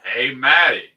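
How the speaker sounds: calling out. Retold or imitated. Acting out Stillwell hailing Maddie at the train, calling out. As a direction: holler it as if across a train platform.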